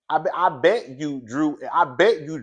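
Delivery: usual speaking voice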